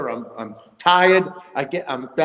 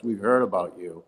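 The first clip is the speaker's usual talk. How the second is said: low, soft mock-menacing umpire; a lightly voiced umpire sizing him up, low and quiet; ominous umpire; 8 semitones lower, 4 dB quieter than usual. Umpire warning the batter he knows him, low and ominous. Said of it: "the umpire goes".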